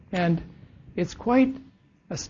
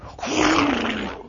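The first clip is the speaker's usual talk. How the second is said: laugh; 6 semitones higher than usual. Real, not acted. Preacher laughs at horse image.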